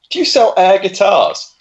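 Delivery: earnest slow question. Retold or imitated. Customer earnestly asking the clerk for air guitars, slow and sincere. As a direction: spell it out slowly like a guileless customer. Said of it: "Like that".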